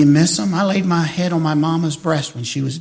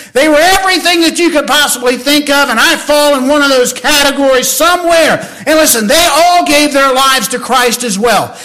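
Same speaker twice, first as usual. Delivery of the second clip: preaching at full force; 5 semitones higher, 4 dB louder than usual. Acted. Preaching climax.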